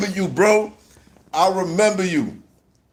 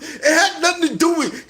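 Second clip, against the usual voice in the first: normal talking voice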